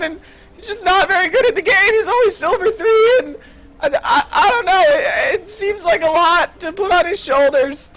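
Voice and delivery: high, stammering nervous Morty. Acted. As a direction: stutter it out anxiously, voice up high. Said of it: "I'm gonna start doing a Rick and Morty impression now".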